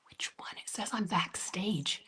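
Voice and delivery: whispered, slow and to yourself; baffled whisper at the app; puzzled whisper. Real, not acted. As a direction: read the screen under your breath. Confused, talking to herself.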